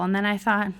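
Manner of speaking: usual speaking voice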